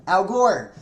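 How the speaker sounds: loud, high blurted name; loud outburst. Real, not acted. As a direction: shout the name out suddenly. Blurts name before reading it.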